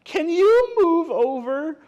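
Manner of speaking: high, loud, slow critter voice; pushy storybook animal; squashed animal character. Acted. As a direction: ask it high and drawn out. Animal squeezed in the hiding tree.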